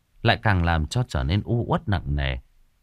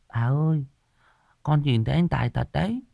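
put-on child confiding; 9 semitones higher than usual. A narrator's reading. Boy tells grandma about a scary woman in red, lightly confiding. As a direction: confide lightly in a raised little-boy pitch. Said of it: "Thằng Hùng thì thầm với bà".

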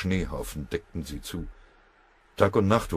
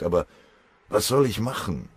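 ordinary delivery

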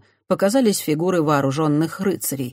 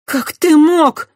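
flung reproach; 13 semitones higher, 10 dB louder, much slower than usual. A narrator's reading. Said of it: "бросила Христиана священнику".

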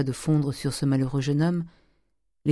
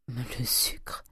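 small, very soft answer; whispered request; 2 semitones lower, 9 dB quieter than usual. A narrator's reading. Charles asking the master for sugar, whispered. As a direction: whisper it almost without voice. Nephew timidly asks for sugar.

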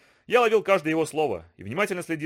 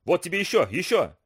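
furious; 4 semitones higher than usual. Acted. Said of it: "кричал я, входя в раж".

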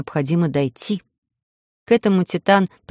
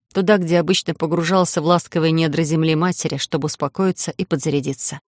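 usual speaking voice

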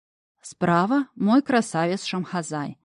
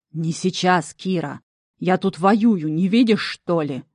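demon voice; slower than usual. A narrator's reading. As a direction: grumble like a busy battle demon. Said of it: "Глубокий утробный голос демона".

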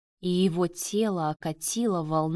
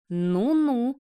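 higher, drawling taunt; drawn out; 5 semitones higher than usual. A narrator's reading. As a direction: stretch both syllables teasingly. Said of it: "протянул он". (насмешливо, нараспев)

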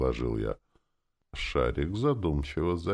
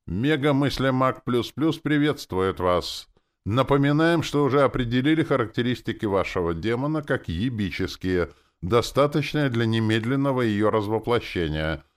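high, loud official announcer; automated announcement; 9 semitones higher, 6 dB louder than usual. A narrator's reading. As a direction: proclaim it like a system notice. Reads out a program's automated message.

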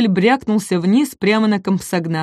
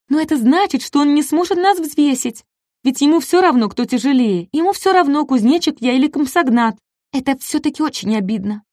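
high, hurt complaint; aggrieved little dinosaur; 7 semitones higher than usual. Acted. Ком обиженно жалуется Даку. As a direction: sound wounded and small.

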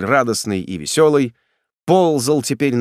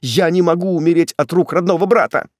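high outcry, then agitated plea; desperate protest; 6 semitones higher, 3 dB louder than usual. A narrator's reading. Condemned man to his brother, desperate cry. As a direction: protest against dying.

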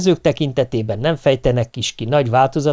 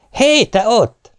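high, slow, loud shout across the garden; shouted call; 10 semitones higher, 4 dB louder, much slower than usual. A narrator's reading. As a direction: call out sharply to the girl. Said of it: "kiáltottam rá". (elnyújtott, hangos kiáltás)

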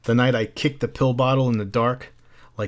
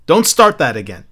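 snapping; 4 semitones higher, 9 dB louder than usual. Acted. Mildred snapping at Montag.

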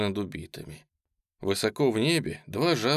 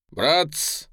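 raised, urgent call; pitched-up exclamation to a crowd; exclaiming; 6 semitones higher, 4 dB louder than usual. A narrator's reading. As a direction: cry out to your comrades. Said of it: "воскликнул он".

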